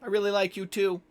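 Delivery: loud, high call from afar; calling from down the hallway. Acted. Game master as an overheard character answering a whisper, calling out loudly. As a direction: holler it back down a hallway. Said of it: "You hear from down the hallway".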